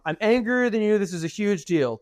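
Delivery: hammed-up indignation; mock angry. Shown as a demonstration. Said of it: "So I use the word angry".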